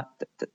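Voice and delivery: "的" as a soft, barely sounded d; light D. Shown as a demonstration. Teacher showing the greyed-out letter D, light d. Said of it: "一个轻轻的发的".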